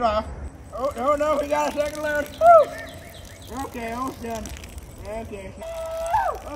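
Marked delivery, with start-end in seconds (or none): 2.4-3.6 high, loud whoop. Real, not acted. Relief whoop. 5.6-6.5 high whoop of relief. Real, not acted.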